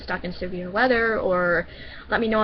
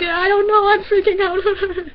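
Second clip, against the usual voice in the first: high, louder tearful panic, slower at the end; retold crying; 12 semitones higher, 9 dB louder, slower than usual. Scared kid home alone crying to mom on the phone, tearful panic. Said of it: "and I start crying".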